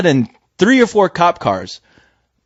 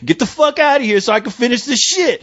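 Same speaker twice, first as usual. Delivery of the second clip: higher-pitched mock telling-off; defiant imagined rant; 6 semitones higher than usual. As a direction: tell off a cop in your head.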